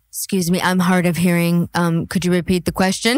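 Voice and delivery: lightly voiced mocking imitation; mock Southern belle. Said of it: "this one is like".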